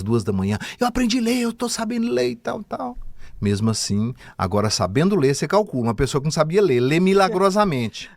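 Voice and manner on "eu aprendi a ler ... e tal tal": high excited shout, much higher pitch; gritando eufórico. Retold or imitated. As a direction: yell it out like big news at 2 a.m. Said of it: "gritei pra todo mundo". (grito animado bem agudo)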